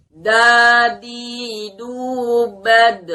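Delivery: high, loud drilled syllables; makhraj drill. Shown as a demonstration. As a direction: sound out dal clearly. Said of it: "kita bunyikan huruf dal".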